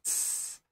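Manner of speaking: tss hissed softly like a snake. Shown as a demonstration. Teacher showing the German Z as a snake hiss. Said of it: "like a snake".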